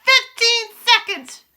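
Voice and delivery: slow, very high, loud call; witch's imperious countdown shout; loud countdown cry. A narrator's reading. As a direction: stretch out each word in a ringing cry. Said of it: "cried the Grand High Witch".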